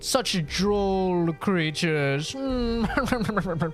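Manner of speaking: high, drawn-out mock scientist voice; snooty scientist. Streamer mocking how games make scientist characters talk, high hammed-up scientist voice. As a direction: ham it up as a pompous scientist, high and slow. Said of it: "make us scientist characters talk like that".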